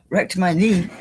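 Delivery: normal talking voice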